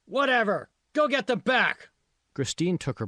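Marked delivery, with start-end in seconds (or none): 0.1-1.9 much higher, louder dismissive yell. A narrator's reading. Supervisor brushing off the girl's correction, yelling. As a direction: wave her off and shout the order. Boss yelling at girl far off.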